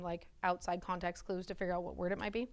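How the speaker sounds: normal talking voice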